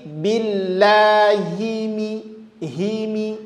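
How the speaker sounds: very slow billahi mi with every i clear; clear kasrah. Shown as a demonstration. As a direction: draw out billahi mi, each i full. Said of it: "jelaskan dia". (billahi mi lambat, kasrah jelas)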